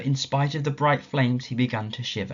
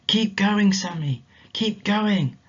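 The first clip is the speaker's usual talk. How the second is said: higher, coaxing voice; gentle encouragement; 9 semitones higher, 5 dB louder, slower than usual. Acted. Mysterious voice urging tired Sammy, gently encouraging. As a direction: urge him on kindly.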